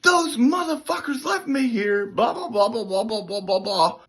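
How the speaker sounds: high-pitched retold angry rant; pissed-off rant. Airman ranting to shop supervisor on the phone, pissed off. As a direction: rattle off an outraged complaint, pitched high. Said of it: "I was pissed".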